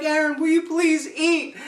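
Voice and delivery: high-pitched imitation of a coaxing hostess; pleading friend's mom. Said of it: "and she's like".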